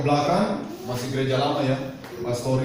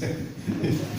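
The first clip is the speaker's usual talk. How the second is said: soft chuckle; 8 dB quieter than usual. Real, not acted. Joke about rubbing eyes.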